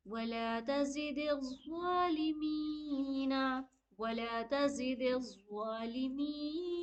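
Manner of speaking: slow, ज़ा full-mouthed and मी stretched; recitation with heavy ज़ा. Shown as a demonstration. Teacher modelling the verse for students. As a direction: recite वला तज़िदिज़ ज़ालिमीना slowly, heavy ज़. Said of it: "blue वाले को मुंह भर के पढ़ेंगे".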